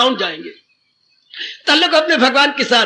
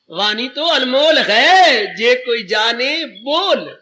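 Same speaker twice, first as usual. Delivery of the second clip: slow recited proverb; much slower than usual. Shown as a demonstration. Quoting a saying as verse.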